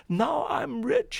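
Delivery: high, slow, jubilant cry; joyful shout. A narrator's reading. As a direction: crow it out to the sky. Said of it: "he shouted".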